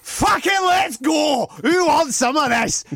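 slow, high, roaring hype; wild shout. Pretend. Host imagines Jojo hyping the crowd, roaring. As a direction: bellow it like a crazed brawler. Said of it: "No, fuck that".

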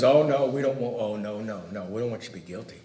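soft, low, fading objection; quiet, dismissive mock refusal; hushed mock protest. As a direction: drop to a low mumble as the protesting world. Voicing the world's objection.

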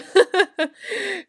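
loud high burst of laughter. Real, not acted. Laughs at own story.

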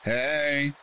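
restrained narrated holler; mild yell. A narrator's reading. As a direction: imitate a man yelling without real volume. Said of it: "some guy leans out and yells".

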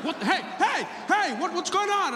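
high-pitched, alarmed shouts of a startled cop; freaked-out policeman. Retold or imitated. Policeman startled by man playing dead, freaked out. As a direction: act out a policeman in a panic, voice leaping up. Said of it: "which freaked him out".

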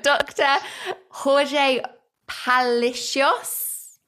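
Doctor Jorge Palacios, slow and halting, with pauses before each name; careful name attempt. Real, not acted. Host nervously attempting her guest's Spanish name, careful and halting "Jorge... Palacios". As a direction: try "Jorge Palacios" carefully in English-accented Spanish, lifting at the end. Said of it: "so much pressure to pronounce your name correctly".

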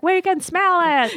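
high, stretched-out mock holler; calling back. Neighbors answering the cook across the atrium, playful call back. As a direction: holler it back slowly from afar.

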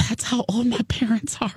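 breathy whispered retelling of the bartender's line; whispered quote. Retold or imitated. Bartender telling her his parents' age, whispered. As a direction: whisper it like a devastating secret. Quoting young bartender's reply.

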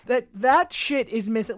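ordinary talk

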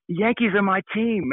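high, slow imitated rock star. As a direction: put on a drawn-out, high-pitched claim. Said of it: "he's like".

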